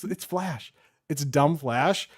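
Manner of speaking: normal talking voice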